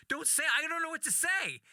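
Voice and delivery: high-pitched flustered protest; mock panic. Comedian to the driver as police run his license, panicked. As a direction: squeal it like a panicking passenger.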